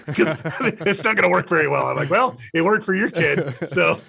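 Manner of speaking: laughing through the words. Real, not acted. Guest laughing while telling the host the dad's warning. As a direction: chuckle out the punchline. Laughing at devil-campaign joke.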